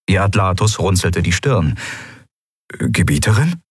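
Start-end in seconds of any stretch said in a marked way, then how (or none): 2.7-3.6 Gebieterin asked with a questioning rise; bewildered, as if he misheard; puzzled. A narrator's reading. Aide baffled by his mistress's refusal, puzzled.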